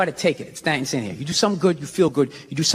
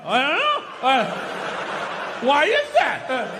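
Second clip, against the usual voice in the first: higher, halting mock confusion, slow at the end; playing dumbfounded; 5 semitones higher, slower than usual. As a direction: fake being baffled, stalling with uh. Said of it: "like, dumbfounded".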